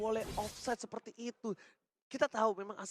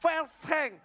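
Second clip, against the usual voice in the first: hyped play-by-play; 8 semitones higher, 4 dB louder than usual. Real, not acted. Live play-by-play at high energy.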